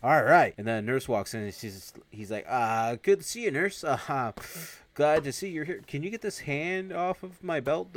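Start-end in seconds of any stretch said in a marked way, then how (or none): 0.0-0.5 louder, higher mock-startled reply. Man grabbed by his pants, startled. 2.5-7.9 higher-pitched imitation of a flustered man. Man caught by a nurse with a hand on him, flustered. Awkward greeting as nurse walks in.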